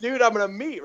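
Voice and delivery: gushing, high-pitched starstruck thrill; retold excitement. Young club worker hears his childhood idol is coming, excited. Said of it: "I was all excited".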